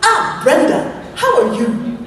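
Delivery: imitating Clara's lilting African accent with a laugh; bright, high greeting in an African accent; playful African-accented hello; West African accent. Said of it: "She talks just like this".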